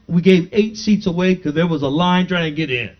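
ordinary talk

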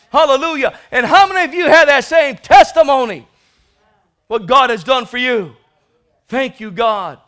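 loud, high climactic preaching; whooping revival preacher; preaching at full force. Acted. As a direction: shout it to the saints. Sermon climax.

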